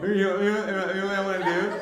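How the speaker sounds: high, soft mock little-kid voice; imitating his son as a little kid. Dad recalling his son's little-kid voice, high, soft mock child. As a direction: squeak it out like an eager young boy. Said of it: "He went from".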